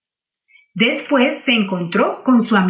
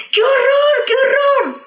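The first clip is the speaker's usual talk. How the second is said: very high, loud shriek of horror; terrified scream; 4 dB louder than usual. Acted. Señorita Cerda screams in terror at the monster, high shriek. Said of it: "gritó".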